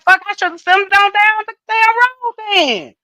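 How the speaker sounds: high, loud curse of frustration; loud retold outburst; exasperated outburst. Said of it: "And I was like".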